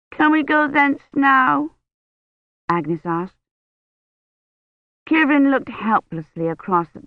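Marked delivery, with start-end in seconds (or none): 0.1-1.7 put-on high, slow child's plea; plaintive little girl. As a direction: ask like a tired small child. Agnes asks to go home.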